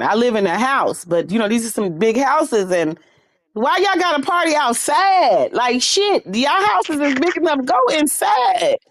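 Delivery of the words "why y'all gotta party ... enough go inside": annoyed yelling. Real, not acted. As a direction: call out to the neighbors, fed up. Yelling at noisy neighbors.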